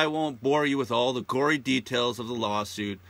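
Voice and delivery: ordinary delivery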